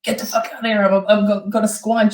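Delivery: high squeaky cat-creature voice; Squanch cat impression. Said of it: "the Squanch cat".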